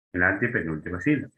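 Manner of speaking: antepenúltima run together quickly in one go; brisk repeat. Shown as a demonstration. Teacher repeating where the accent falls, brisk and joined up. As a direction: say antepenúltima sílaba briskly, without breaks. Repeats the term at normal speed.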